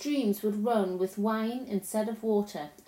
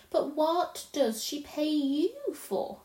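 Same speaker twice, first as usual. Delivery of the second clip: put-on slow, higher little-girl question; innocent, puzzled asking; curious Lucy; 6 semitones higher, slower than usual. Lucy asks about the faun's pay, puzzled.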